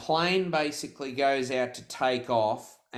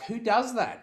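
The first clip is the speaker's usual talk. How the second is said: high, appalled outcry; retold outrage, pitch leaping up; appalled disbelief; 10 semitones higher than usual. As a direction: cry out that it's unthinkable.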